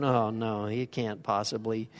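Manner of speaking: mild doubting tut under the breath; a touch of mock disbelief; scornful head-wag. Shown as a demonstration. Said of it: "they're just going".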